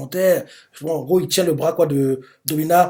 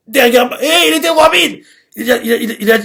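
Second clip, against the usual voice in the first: much higher and louder in sheer amazement; excited; 9 semitones higher, 9 dB louder than usual. Real, not acted. Fan describing how fast Domina moved, excited high outburst. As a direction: blurt it out, stunned by the speed. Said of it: "narrator amazed at speed".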